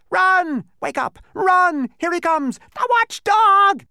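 high, loud frantic alarm; panicked cries. A narrator's reading. Lethargerians fleeing the approaching watchdog, panicked shouts.

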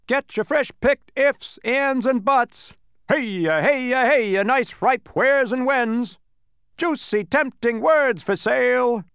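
high, slow market-crier shout; loud hawking. Acted. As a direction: bellow it like a street vendor. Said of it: "loudly advertising their products".

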